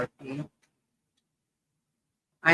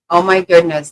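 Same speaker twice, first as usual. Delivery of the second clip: loud shocked oh my goodness; shocked exclamation; 2 semitones higher, 9 dB louder than usual. Real, not acted. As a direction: blurt it out in shock. Seeing flood photos.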